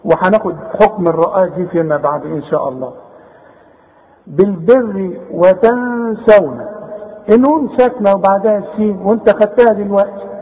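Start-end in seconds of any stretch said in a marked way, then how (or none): 4.3-6.7 very slow, long hum on the noon of "وتنسون"; hidden nasalised noon. Shown as a demonstration. Teacher recites verse for pupil, nasal hidden noon. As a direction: hold the nasal "n" before seen in "وتنسون". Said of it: "نخفي ونغن".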